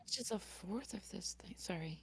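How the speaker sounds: hushed unvoiced apology; whispering. Real, not acted. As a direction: whisper it off-mic. Whispers apology mid-stream.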